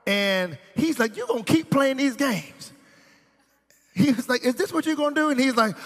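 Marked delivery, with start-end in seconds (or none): 1.2-2.7 high-pitched acted exasperation; exasperated boyfriend. Boyfriend to girlfriend packing to leave, exasperated. 4.5-5.3 high, incredulous challenge; mock indignant question, pitched right up; exasperated boyfriend. Boyfriend challenges girlfriend who is leaving, exasperated.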